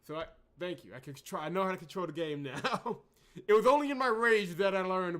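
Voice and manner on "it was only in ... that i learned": laughing through the words, louder. Real, not acted. Streamer laughing at learning controls in a rage. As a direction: laugh at your own fury.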